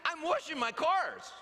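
put-on high, innocent child's voice; little boy voice. Son answering his dad in a high child voice. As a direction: sound like a proud toddler. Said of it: "He said".

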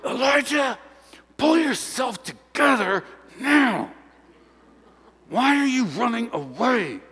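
mock-divine telling-off, held back in volume; scolding whisper. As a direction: reprimand like an exasperated parent in church. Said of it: "came up to Elijah and whispered".

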